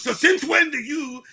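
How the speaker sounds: firm final ruling from dad; father laying down law. Retold or imitated. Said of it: "He goes".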